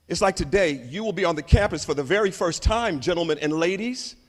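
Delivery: ordinary talk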